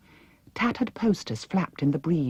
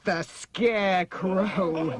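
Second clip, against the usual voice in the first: slow, scornful laughing Wheeler; the Wheeler mocking Dorothy's question; 5 semitones higher, much slower than usual. A narrator's reading. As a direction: drag it out to mock her. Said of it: "laughed the head Wheeler".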